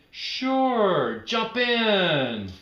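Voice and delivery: high, loud friendly invitation; lightly voiced girl, bright and loud; cheerful friend. Amy inviting Norma Jean to jump in, high and loud.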